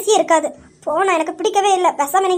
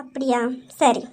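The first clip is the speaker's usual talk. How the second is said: low, soft and slow, holding back threat; slow, quiet warning to the cousin; quiet menace; 6 semitones lower, 5 dB quieter, slower than usual. Acted. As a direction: let it sink in, ominous and calm. Sister-in-law confronted, threatening.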